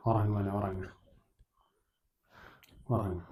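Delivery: soft, low hushing; father whispering his boy to sleep; soothing lull. Acted. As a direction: lull him gently to sleep. Father lulling son to sleep.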